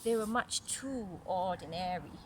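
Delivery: clear, even read-aloud story voice; story narration. A narrator's reading. Mother reading a story to little Caris, storytelling voice. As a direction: read it like a picture book to a child.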